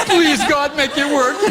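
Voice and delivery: high, laughing mock prayer; laughing plea. Real, not acted. Instructor laughing after the volunteer tests weak, a mock prayer. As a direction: laugh it out as a half-joking plea.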